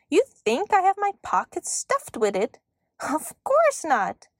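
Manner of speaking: high, incredulous, slowing at the end; scoffing elf. A narrator's reading. Elf asked for seeds right now, scoffing.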